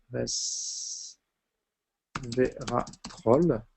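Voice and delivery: resveratrol drawn out very slowly and softly; very slow dictation. Shown as a demonstration. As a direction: stretch resveratrol out while writing it down. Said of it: "je vais vous le noter".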